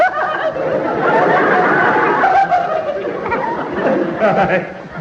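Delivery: high, smug chuckle at his own wit; pleased chuckle at own pun. Acted. Man chuckling at his fish pun to Mister Sinclair, smug laugh.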